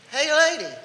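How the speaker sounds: high, loud parrot call; squawking parrot. Acted. Parrot calling to a passing lady, high squawky shout. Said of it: "and the parrot says".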